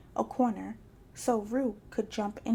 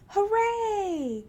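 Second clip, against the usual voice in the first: squealing; 10 semitones higher, 3 dB louder than usual. A narrator's reading. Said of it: "squealed Roo".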